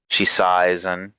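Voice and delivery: ordinary talk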